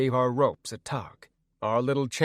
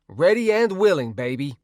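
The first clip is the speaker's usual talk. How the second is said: eager flirty; 5 semitones higher, 6 dB louder than usual. Acted. Tweak eager reply.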